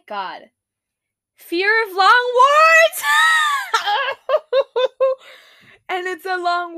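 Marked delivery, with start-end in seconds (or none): 1.4-3.0 shouting at the top of her lungs, higher; shocked shout. Real, not acted. Reading a phobia list to viewers, shouting in shock. 3.1-5.4 loud high shrieking laugh. Real, not acted. Laughing at a silly phobia name for viewers, a shrieking laugh.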